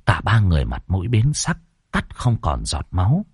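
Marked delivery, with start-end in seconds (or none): none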